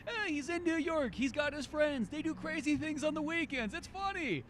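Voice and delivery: high, slow, soft carefree voice; mock breezy. Mocking a casual first watch of a comedy, high and breezy. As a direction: lightly play the naive viewer.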